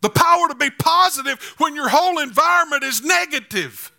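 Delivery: fervent sermon delivery, rising to shouts; preaching. Real, not acted. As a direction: preach it with full conviction. Preaching sermon at full force.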